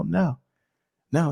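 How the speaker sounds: ordinary delivery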